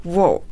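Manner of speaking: "will" reduced to "wol", almost "wow"; native weak will. Shown as a demonstration. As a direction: swallow the vowel of "will" into "wo'l". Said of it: "willはネイティブが発音すると". (「will」を弱形で「ウォゥ」と)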